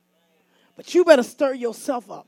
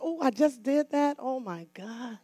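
shocked whisper; 10 dB quieter than usual. Retold or imitated. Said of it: "I was so shocked".

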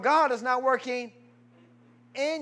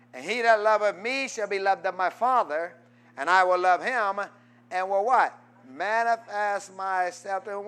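ordinary delivery

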